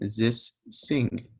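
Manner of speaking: put-on Egyptian accent, "zis sing" with z and s instead of th. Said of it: "speak to an Egyptian ... they're gonna say".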